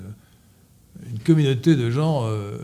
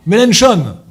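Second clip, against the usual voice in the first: loud announcement; 8 semitones higher, 11 dB louder, slower than usual. Real, not acted. Announcing the next name.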